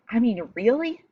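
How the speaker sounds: "really" pushed up into an incredulous question; fed-up rising stress on "really"; over it, stress on really. Acted. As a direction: lean on "really" as if you're over it. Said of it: "he's just over it".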